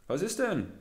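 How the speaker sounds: ordinary delivery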